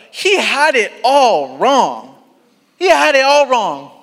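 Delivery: slow, high, loud stress on wrong; preacher driving home that he was wrong; emphatic. Real, not acted. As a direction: lean hard on "wrong". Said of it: "I'm just gonna be straight".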